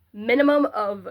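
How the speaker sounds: ordinary talk, nothing marked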